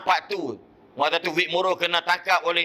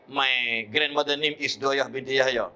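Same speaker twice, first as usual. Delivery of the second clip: usual speaking voice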